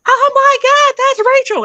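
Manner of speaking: high, loud shocked shriek; excited scream. Retold or imitated. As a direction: shriek it across the room. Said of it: "my mom screamed across the room".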